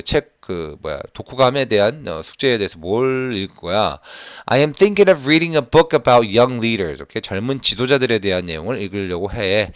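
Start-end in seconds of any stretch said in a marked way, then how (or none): 4.5-7.0 very slow, louder model reading; slow read-aloud. Shown as a demonstration. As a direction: spell out the sentence slowly for students. Teacher reads dialogue line. (천천히 크게 읽기)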